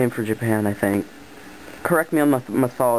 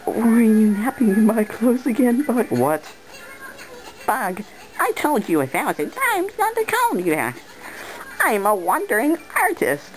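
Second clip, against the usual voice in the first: much higher cartoon voice; high character voice; 10 semitones higher than usual. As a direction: voice a cheeky little sidekick.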